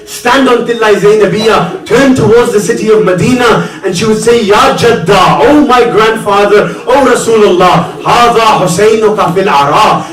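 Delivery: high, loud, urgent lamenting preacher; impassioned mourning sermon. Acted. Impassioned lament preaching of Karbala tragedy.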